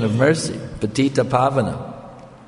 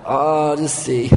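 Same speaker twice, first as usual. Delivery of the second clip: hammed-up mocking laugh after a drawn jeer; mocking laughter; 3 semitones higher, much slower than usual. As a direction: imitate a crowd laughing at a fool. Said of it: "So everybody was laughing".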